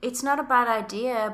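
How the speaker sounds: normal talking voice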